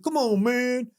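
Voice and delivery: high, drawn-up retold plea; mock protest. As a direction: imitate the teased agent objecting. Ribbed agent protests, retold.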